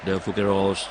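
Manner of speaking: calling the foul with a shout, then steadier commentary; live play-by-play. Acted. Commentator calling the final minutes for viewers, urgent play-by-play. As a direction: push the late-game urgency.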